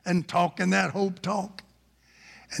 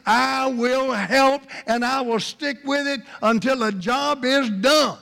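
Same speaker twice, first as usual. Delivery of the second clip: raised, forceful preacher's pledge; higher-pitched, emphatic vow in character; earnest promise; 7 semitones higher, 3 dB louder than usual. Acted. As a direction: declare it with firm conviction. Voices Love's promise to help.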